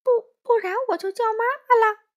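high, loud, fast mimicry; mocking imitation. A narrator's reading. Wolf mimicking the scared little one, mocking stammer. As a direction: ape the stammering kid mockingly. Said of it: "帅狼学着他的腔调说".

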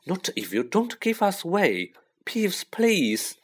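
high, anxious plea; pleading. A narrator's reading. Pleading with Peeves.

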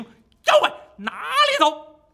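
a very high, loud shout, stretched out slowly; Monkey King's battle cry in pursuit. Acted. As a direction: roar after the fleeing demon. Wukong shouts while chasing.

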